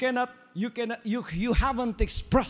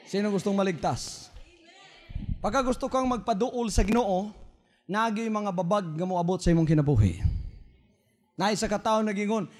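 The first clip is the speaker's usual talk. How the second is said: normal talking voice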